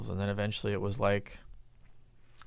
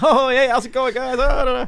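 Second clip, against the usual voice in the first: higher, louder mock schmoozing hello; fawning greeting; 13 semitones higher, 8 dB louder than usual. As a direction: ham up an eager salesman greeting the buyers. Vendors greeting Nordstrom buyers.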